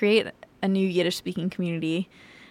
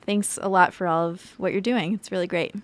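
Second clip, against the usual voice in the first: ordinary talk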